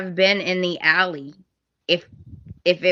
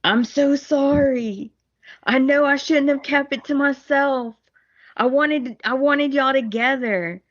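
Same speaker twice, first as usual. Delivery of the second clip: pleading apology; 6 semitones higher than usual. Retold or imitated. Thomas apologising to Ridge, high and pleading. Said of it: "he's like".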